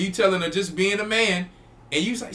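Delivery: ordinary talk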